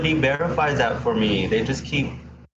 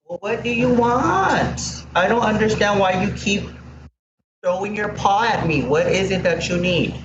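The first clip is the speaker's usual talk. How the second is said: higher, coaxing voice for a pet; talking to the dog; 6 semitones higher than usual. Real, not acted. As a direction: coax the dog, puzzled and higher. Talking to her pug Ellie.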